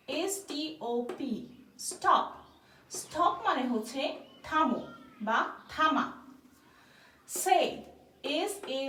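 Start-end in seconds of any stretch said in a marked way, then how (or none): none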